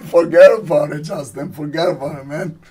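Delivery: mock mobster, punched opening then easing off; hammed-up gangster brush-off; Al Pacino. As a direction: lightly imitate an old Italian mobster. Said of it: "This is my impersonation of Al Pacino".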